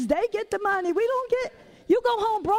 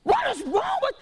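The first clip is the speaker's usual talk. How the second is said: retold yell; 8 semitones higher than usual. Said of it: "my husband started yelling".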